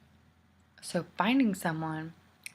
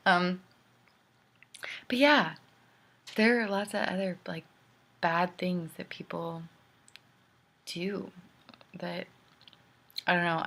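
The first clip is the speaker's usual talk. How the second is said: usual speaking voice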